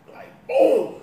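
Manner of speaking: loud, high boom of a thud; mock crash. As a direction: slam the word like a heavy fall. Son falling out of bed.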